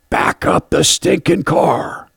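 high, slow yelled command; angry yell from the truck window; retold yell. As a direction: bark it out at the stalled driver. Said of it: "I yelled at her".

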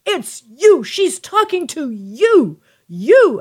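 loud, high accusing shout of You; mock furious woman pointing and yelling; hateful accusing yell.

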